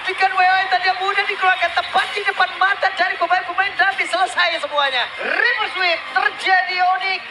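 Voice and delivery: loud triumphant play-by-play; esports caster. Acted. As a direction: announce the reverse sweep. Calling the comeback win.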